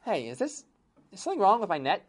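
usual speaking voice

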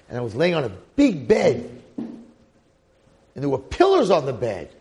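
loud, amazed memory flooding back; acted wonder, punched out loudly; excited recollection. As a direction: build to big, drawn-out key words. Excited as childhood memory returns.

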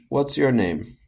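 usual speaking voice

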